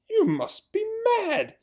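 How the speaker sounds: very high, slow mocking voice; jeering villager. Villager jeering the farmers, high mocking voice. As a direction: jeer it at them.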